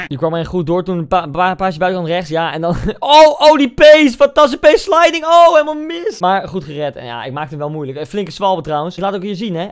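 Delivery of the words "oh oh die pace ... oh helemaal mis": loud, high, slow cries of glee; hyped shouting over the replay; excited. Real, not acted. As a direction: cry out in delight at the slide. Reacting to own slide tackle.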